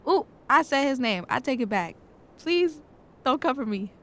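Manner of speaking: hammed-up scared plea; mock panic. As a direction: plead with the ghost, half-joking. Realises she said his name.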